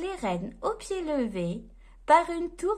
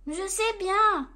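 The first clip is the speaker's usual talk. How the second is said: lightly voiced little elf, a bit higher; 5 semitones higher, 4 dB louder than usual. A narrator's reading. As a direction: answer as a hint of a worried elf. Elf replies to Santa.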